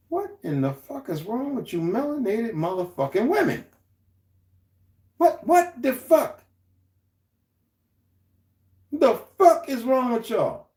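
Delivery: high, drawn-out incredulous outrage; can't believe what he's hearing; stretched, disgusted what the fuck; outraged disbelief. Real, not acted. Outraged rant at women.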